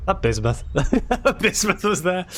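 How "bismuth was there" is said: high laugh running into words; laughing delight. Acted. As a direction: giggle through the words. Laughing at the vision.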